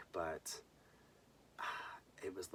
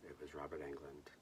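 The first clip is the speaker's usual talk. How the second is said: usual speaking voice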